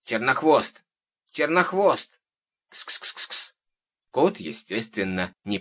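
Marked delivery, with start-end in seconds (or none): 0.0-2.2 high, loud pet call. A narrator's reading. Old woman calling her cat in the clearing, calling out. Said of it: "бабка деловито огляделась и позвала". 2.7-3.5 voiceless "ks-ks-ks" to summon a cat; cat-calling hiss. A narrator's reading. Old woman summoning her missing cat, cat-calling hiss. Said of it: "бабка деловито огляделась и позвала".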